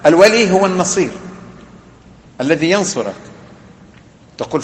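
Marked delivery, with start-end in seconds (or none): none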